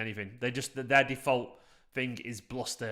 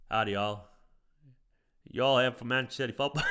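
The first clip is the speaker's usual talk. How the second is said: put-on dodgy Texan accent. Streamer showing viewers his Texan, dodgy accent breaking into laughter. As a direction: attempt a bad Texas accent. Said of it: "I need to improve my accent".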